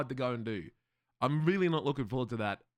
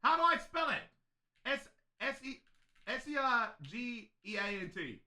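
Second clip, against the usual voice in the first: whiny pleading; 8 semitones higher, 4 dB quieter than usual. Real, not acted. Asking chat how to spell sergeant.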